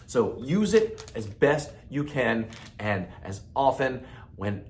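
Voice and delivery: ordinary delivery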